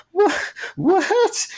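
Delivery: high squealing mock "What? What?"; mock surprised girls. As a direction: squeal it like a starstruck party girl. Retold girls reacting to scientist.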